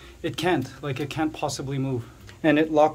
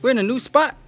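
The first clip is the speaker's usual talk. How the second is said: high, loud voice for startled iguanas; mock panic, pitched way up; freaking-out iguanas; 8 semitones higher, 7 dB louder than usual. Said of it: "freaking out. They're like".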